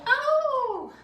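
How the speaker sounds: high, loud excited oh; excited shout. Real, not acted. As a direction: burst out with excitement.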